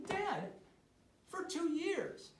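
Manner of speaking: high pitched protest to dad; exasperated. Retold or imitated. Son protests to his father: high exasperated. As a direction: plead your case, disbelieving.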